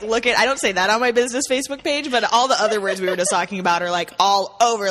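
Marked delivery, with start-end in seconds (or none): none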